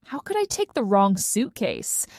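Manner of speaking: ordinary delivery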